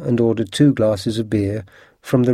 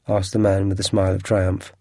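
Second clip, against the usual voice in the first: ordinary delivery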